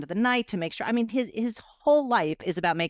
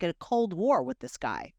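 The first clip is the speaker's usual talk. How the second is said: normal talking voice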